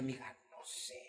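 voiceless rasp, no voice left; hoarse whisper. Retold or imitated. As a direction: whisper through a wrecked throat.

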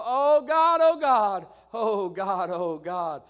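loud, high, wailing oh God, fading then surging; imitating the repetitive prayer. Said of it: "and he'd go".

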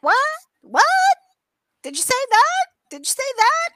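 high, loud puzzled drive-through clerk; mock confused clerk. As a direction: squeak out baffled repeats. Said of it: "It was like".

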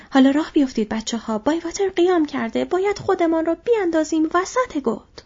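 rallying shout. Acted. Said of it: "فریاد زد".

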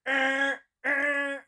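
loud, high blaring alarm buzz; honking alarm noise, in separate bursts; fire alarm imitation. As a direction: imitate a repeating alarm buzzer. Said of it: "It's a fire alarm".

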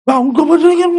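high, loud panicked shout; panicked cry. Retold or imitated. Disciples in the storm crying out to their master, panicked. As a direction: blurt it out in alarm. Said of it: "Dia pasti panik dong".

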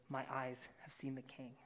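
hushed whisper of awe; unvoiced reverent whisper. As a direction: breathe the words in wonder. Reading Isaiah's vision aloud.

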